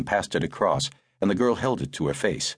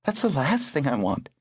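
agonized; 8 semitones higher than usual. A narrator's reading. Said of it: "a kind of agony there".

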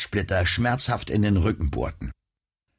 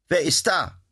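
high, tense call into the dark; nervous call downstairs; 9 semitones higher than usual. Acted. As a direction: call out warily, afraid of the answer. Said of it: "rief Nora nach unten".

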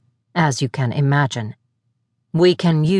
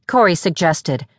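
ordinary delivery, nothing marked

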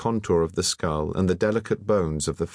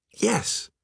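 high-pitched excited yes; excited cry. A narrator's reading. Alain approving the painter's brush touch, excited. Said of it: "cried Alain excitedly".

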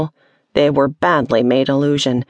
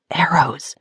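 "Arrows." breathed out as a whisper; whispered realisation. A narrator's reading. Wren recognising the fire.

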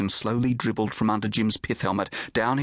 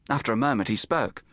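ordinary delivery

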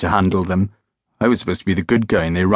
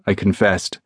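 ordinary delivery

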